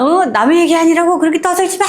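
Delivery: high, fast, fierce telling-off; scolding grandmother. Retold or imitated. Grandmother telling off gossiping neighbors, fierce and fast. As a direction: snap back at the gossips.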